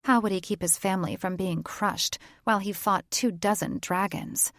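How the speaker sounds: usual speaking voice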